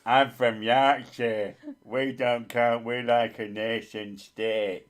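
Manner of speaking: slow, loud hammed-up Yorkshire accent; mock Yorkshire accent. Teasing a Yorkshire friend about nationhood, mock Yorkshire accent. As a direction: drag it out in a proud mock Yorkshire voice. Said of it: "is this one of those Yorkshire things".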